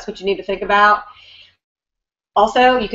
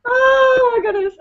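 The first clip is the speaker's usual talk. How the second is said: laughing it off; 3 dB louder than usual. Real, not acted. Recovering from laughing fit.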